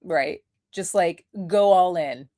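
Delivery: ordinary delivery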